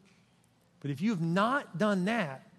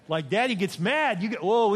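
ordinary talk

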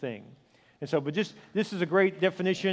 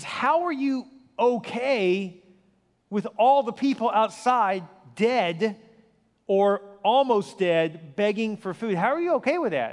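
pleading disbelief; 3 semitones higher, slower than usual. Retold or imitated. Pastor begging a calm shopkeeper to explain himself, slow and appalled. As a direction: drag it out, appalled at the indifference. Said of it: "He says".

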